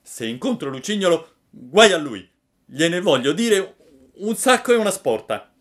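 high, loud threatening puppet; angry threat. A narrator's reading. Pinocchio threatens Lucignolo, loud and angry. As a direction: swear revenge.

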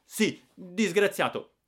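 high, loud and slow angry retort; snapping back. Acted. Giannino snapping at his brother-in-law, loud and high. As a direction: throw the word back furiously. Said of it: "e scattai".

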